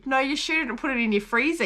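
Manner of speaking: ordinary talk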